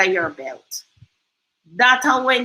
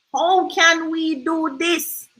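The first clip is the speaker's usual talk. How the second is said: very slow thinking-aloud; slow, drawn-out question; 5 semitones higher, much slower than usual. Real, not acted. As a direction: stretch "How can we do this" slowly.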